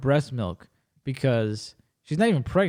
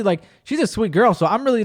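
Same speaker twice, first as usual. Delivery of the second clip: ordinary delivery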